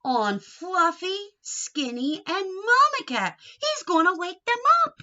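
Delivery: high, loud, rising excitement; excited. Acted. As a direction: build up the pounce excitedly. Excited pounce climax.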